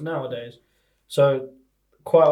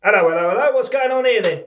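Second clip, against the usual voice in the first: higher-pitched stock bobby greeting; mock policeman; 4 semitones higher, 4 dB louder than usual. Policeman to friend caught at something, mock-official. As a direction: play a comic policeman arriving on the scene. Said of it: "You be the policeman".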